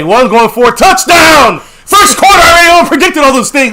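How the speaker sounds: loud, triumphant shouting; excited shouting. Real, not acted. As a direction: yell it out like your call just came true. Hyped prediction comes true.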